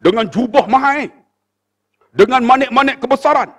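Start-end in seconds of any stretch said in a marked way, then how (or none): none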